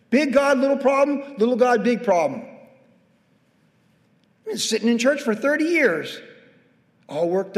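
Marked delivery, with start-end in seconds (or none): 0.1-2.4 punchy slogan. Acted. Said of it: "preached slogan".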